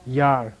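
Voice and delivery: Yar in a low, drawn-out tone. Shown as a demonstration. Teacher models Tibetan low tone for students, low tone on Yar. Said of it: "Low tone, remember?".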